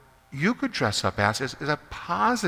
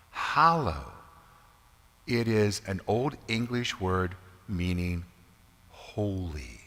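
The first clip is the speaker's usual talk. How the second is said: ordinary talk, nothing marked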